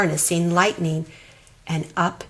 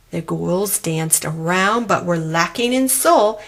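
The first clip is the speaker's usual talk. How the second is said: ordinary delivery, nothing marked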